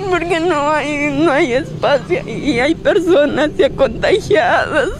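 crying through the words; sobbing, voice breaking; blubbering. Real, not acted. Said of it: "Ella lloraba de impotencia".

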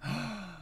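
high, quiet intake of breath; real excited gasp; sharp gasp. Real, not acted. Reacting to a character's return.

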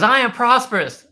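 high, loud imitation of a child; mock kid's shout; daughter echoing loudly. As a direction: yell it back like an eager little girl. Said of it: "She goes".

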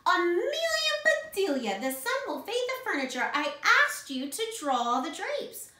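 high, loud telling-off; exasperated scolding. A narrator's reading. Acted scolding by Missus Rogers.

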